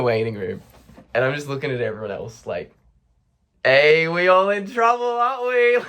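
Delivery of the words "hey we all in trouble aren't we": jokey calling out. Retold or imitated. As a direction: hail a whole room, pitch climbing. Calling out to the waiting room.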